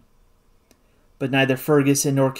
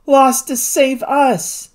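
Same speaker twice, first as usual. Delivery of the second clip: grieving; 8 semitones higher, much slower than usual. A narrator's reading. Grieving reply after friend's sacrifice.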